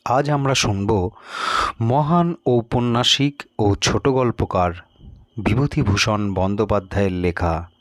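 ordinary delivery, nothing marked

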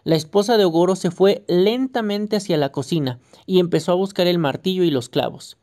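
ordinary delivery

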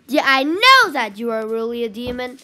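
ordinary delivery

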